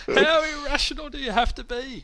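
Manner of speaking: excited high-pitched outrage at irrational people. Real, not acted. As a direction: squeal the disbelief up high with a laugh. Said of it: "I'm using my high voice".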